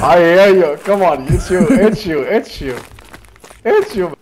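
loud, excited recognition. Real, not acted. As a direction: shout it out, thrilled.

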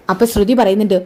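ordinary delivery

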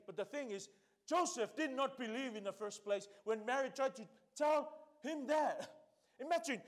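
usual speaking voice

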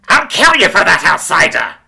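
screech. A narrator's reading. Isadora screeches a threat at Jack, very high and loud. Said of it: "He heard Isadora screech out".

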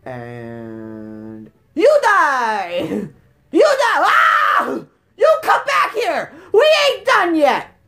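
drawn-out words bursting into high shouts; hammed-up loud taunting; gleeful battle yell. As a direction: stretch it out, then yell at the fleeing enemy. Yelling at a game enemy.

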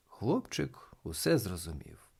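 plain reading voice

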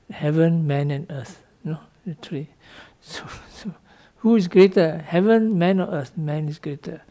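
normal talking voice

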